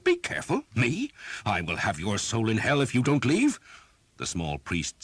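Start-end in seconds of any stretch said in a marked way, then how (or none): 0.0-3.6 very high, loud outraged yell; furious shout. Acted. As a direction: throw the threat back in his face. Priest explodes at the threat.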